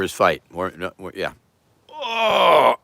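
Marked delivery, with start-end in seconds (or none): 1.9-2.8 effortful groan. Pretend. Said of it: "Mike Tyson waking up in the morning".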